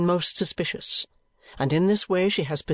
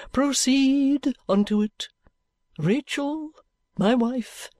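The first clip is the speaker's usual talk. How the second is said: high, slow, unctuous sermonizing voice, drawn out; a sleek preacher savouring every rhetorical question; oily preacher; 7 semitones higher, slower than usual. Acted. Chadband preaching to the gathered company in a high, slow, oily voice.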